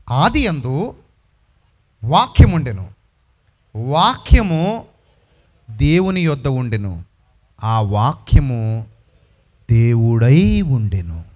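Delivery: very slow, low and loud, word by word; low, slow, ringing pulpit reading; solemn scripture intoning. Acted. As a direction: drag each word out in deep reverence. Intoning John 1:1.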